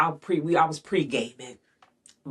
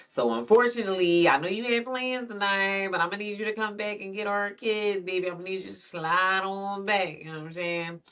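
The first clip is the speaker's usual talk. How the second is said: mock sweet apology; 8 semitones higher than usual. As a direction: mockingly sweet-talk him into coming back. Mimicking sweet apology to boyfriend.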